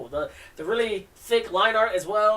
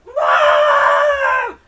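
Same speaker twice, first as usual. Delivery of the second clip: high piercing scream, much louder; screaming for mom; 14 dB louder than usual. Acted. Girl screams for her mom, a loud shriek. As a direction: shriek it out at full force.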